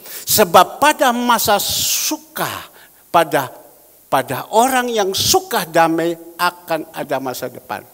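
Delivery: full-force sermon reading; preaching at full force. Acted. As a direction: boom the verse out to the congregation. Proclaims the scripture verse.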